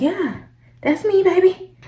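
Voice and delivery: high, sing-song flirty reply; sassy. Retold or imitated. Retelling her sassy reply to agent.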